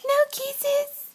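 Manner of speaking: put-on high sing-song baby talk. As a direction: coax a toddler in a high playful voice. Mother coaxing toddler, baby talk.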